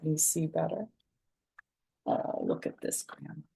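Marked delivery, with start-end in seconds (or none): 2.1-3.4 hushed whisper. Real, not acted. Grandma whispering at picture of grandma, hushed. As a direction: whisper the discovery.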